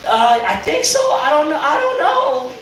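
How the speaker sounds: 높은 목소리로 머뭇거리며 겁먹은 친구들 흉내; nervous friends. Retold or imitated. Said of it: "my friends are like".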